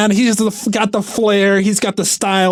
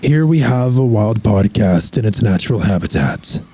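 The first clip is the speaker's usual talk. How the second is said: nature documentary; 8 semitones lower than usual. Acted. Nature documentary style intro.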